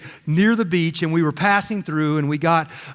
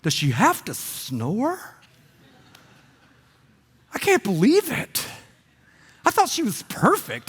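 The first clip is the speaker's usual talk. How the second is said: disillusioned husband; 3 dB quieter than usual. Retold or imitated. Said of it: "you're like".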